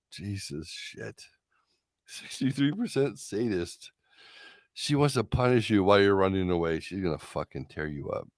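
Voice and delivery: usual speaking voice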